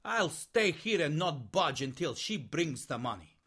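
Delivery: ordinary talk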